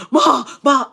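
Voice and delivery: loud frantic cry for mom; panicked calling. Retold or imitated. Son calling his mother after the shooting, panicked and loud.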